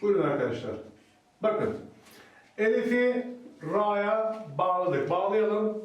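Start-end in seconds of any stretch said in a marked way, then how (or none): none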